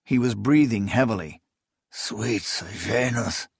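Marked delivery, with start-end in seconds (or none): 1.9-3.5 hissed, much softer and slow. A narrator's reading. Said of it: "he hissed".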